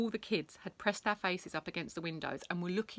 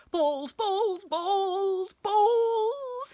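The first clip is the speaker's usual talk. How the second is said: high, loud, drawn-out chant; crazed, obsessive babble; manic repeating to himself; 8 dB louder, much slower than usual. A narrator's reading. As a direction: repeat it as if gone bananas. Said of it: "muttering to himself over and over".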